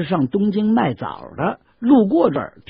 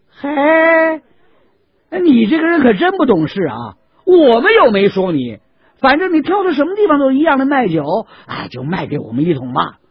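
higher, louder wheedling; coaxing buyers; 10 semitones higher, 6 dB louder than usual. Acted. 卖枣人劝大汉卖给他们一桶酒. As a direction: talk him round into selling. Date sellers coax the seller.

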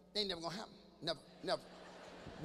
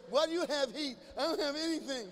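put-on whiny teenager; 5 semitones higher than usual. Teen voiced losing the heat.

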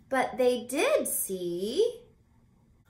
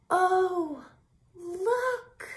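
much higher, louder excited oh look; delighted surprise; 10 semitones higher, 4 dB louder than usual. Acted. Charles spots the wish tree, high delighted surprise. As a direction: gasp with wonder and point.

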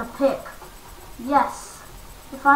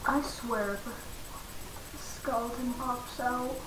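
soft, slow whispered threat; tense whisper; 10 dB quieter, slower than usual. Real, not acted. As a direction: hush it, afraid of skeletons.

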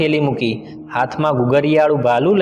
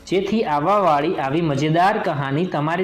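outro talk; 3 semitones higher, 4 dB quieter than usual. Real, not acted. The narrator asks viewers to like and subscribe, in a chatty sign-off. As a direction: wrap up and ask for likes. Channel outro after story.

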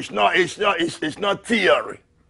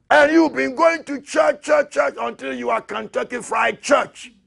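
fiery preaching; 7 semitones higher than usual. Acted. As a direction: preach it at full force to the church. Preaching at full force.

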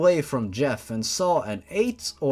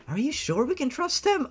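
put-on high girl voice; 11 semitones higher than usual. Girl asks nervous question.

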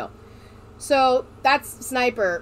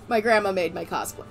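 ordinary talk, nothing marked

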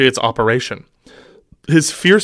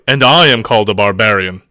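disgusted grunt; 2 semitones lower, 5 dB louder than usual. Shown as a demonstration. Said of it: "Oh, it's more like".

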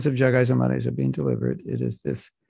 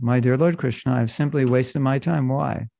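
ordinary talk, nothing marked

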